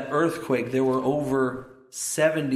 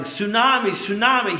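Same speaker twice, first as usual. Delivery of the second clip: louder, high retold warning shout; imitated shouting; 8 semitones higher, 5 dB louder than usual. Preacher imitating the man who won't stop, shouting. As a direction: imitate urgent shouts of alarm, pitched high. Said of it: "he doesn't stop shouting".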